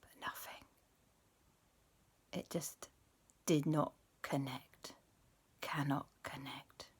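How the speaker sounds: suspenseful whisper. A narrator's reading. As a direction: whisper it like the call is dead. Suspenseful failed call.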